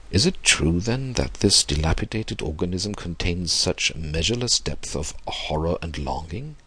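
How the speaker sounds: usual speaking voice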